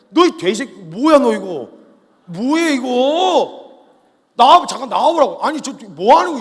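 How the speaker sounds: very loud, high, slow furious yelling; furious shout. Acted. David yelling at Goliath across the field, furious. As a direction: scream the challenge at the giant.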